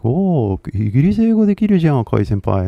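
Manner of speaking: lightly acted admiring fans, quick; impressed fans. Viewers praising his British English, mock-impressed. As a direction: imitate impressed viewers in a rush. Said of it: "maybe you guys would, like".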